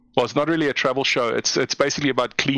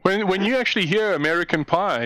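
ordinary talk, nothing marked

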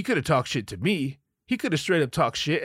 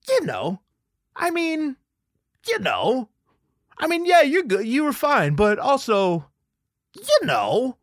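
much higher, hedging, halting wife voice; hesitant wife; 14 semitones higher, slower than usual. Retold or imitated. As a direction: play his wife dodging the answer nervously.

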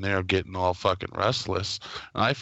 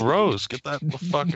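high-pitched mock revulsion; retold disgust; 7 semitones higher than usual. Son rejecting mom's cream corn, mock disgusted. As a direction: push it away with acted disgust. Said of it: "this all disgusted look".

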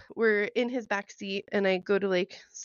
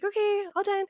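much higher, chirpy done with it; mock curt brush-off; 10 semitones higher than usual. Woman ending the make-out with her date, chirpy mock brush-off. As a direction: cheerfully shut the date down. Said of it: "I was just like".

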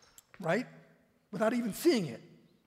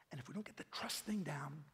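whispered aside; 3 semitones lower, 5 dB quieter than usual. Real, not acted. Intimate sermon aside.

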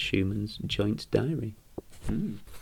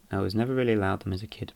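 ordinary talk

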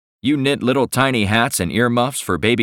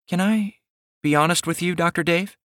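higher, sweet shy woman's voice; shy young woman; 10 semitones higher than usual. Acted. Millie speaks to Dave.

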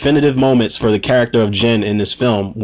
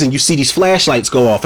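ordinary talk

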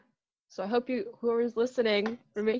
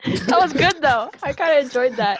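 excited; 5 semitones higher, 8 dB louder than usual. Real, not acted. Excited praise after sound.

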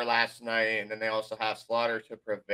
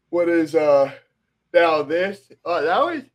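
talking through a yawn; words stretched by a yawn; 5 semitones higher, 7 dB louder than usual. Real, not acted. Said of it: "I'm sorry that I'm yawning".

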